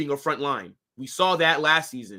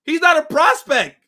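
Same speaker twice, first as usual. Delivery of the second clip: loud frustrated yelling; 10 semitones higher, 9 dB louder than usual. Real, not acted. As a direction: yell it out at chat. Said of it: "And I'm not yelling at you".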